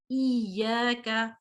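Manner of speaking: ईया का slow with the ya held twice; doubled ya. Shown as a demonstration. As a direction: give both ya sounds time in ईया. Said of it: "एक दफा वो ई फिर या".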